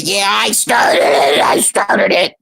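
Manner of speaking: a mock shout of her admission in a put-on voice. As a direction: throw her words back, hammed-up. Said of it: "And then you said".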